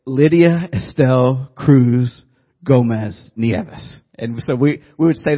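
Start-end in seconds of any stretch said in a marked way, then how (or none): 0.0-3.8 slow, loud Spanish pronunciation of Lydia Estelle Cruz Gomez Nieves; proud child reciting grandma's names; child's name recital. Retold or imitated. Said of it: "And I'd say".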